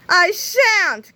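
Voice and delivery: high, loud refusal; defiant cry. A narrator's reading. Captain Underpants refuses Melvin's order, loud defiant cry. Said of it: "cried Captain Underpants".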